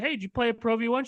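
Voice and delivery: high, quick imitation of the friend; retold challenge. Friend asks a stranger about the ball, high-pitched challenge. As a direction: put the challenge up high and fast.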